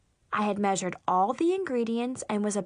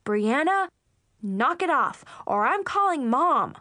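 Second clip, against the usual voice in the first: raised, exasperated warning; threatening; 3 semitones higher, 4 dB louder than usual. Acted. Older sister threatening to call mom, exasperated. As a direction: punch out a bossy ultimatum. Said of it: "I threatened".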